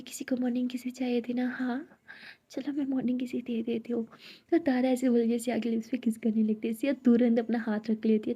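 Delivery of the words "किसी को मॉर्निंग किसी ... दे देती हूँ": soft teasing whisper, close to her ear. Acted. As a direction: breathe the words playfully, unvoiced. Tara teases Siya with a kiss. (चिढ़ाती हुई धीमी फुसफुसाहट)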